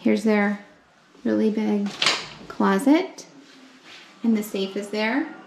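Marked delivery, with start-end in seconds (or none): none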